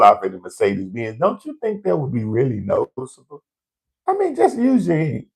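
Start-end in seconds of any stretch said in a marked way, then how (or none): 4.1-5.2 exasperated. Real, not acted. Scolding the robbers, exasperated.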